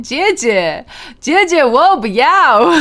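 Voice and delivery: loud, drawn-out, hammed-up Chinese with a Texan twang; mock Chinese-Texan accent. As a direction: ham up Chinese as a Texan would say it. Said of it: "Lena should be Chinese, Texan".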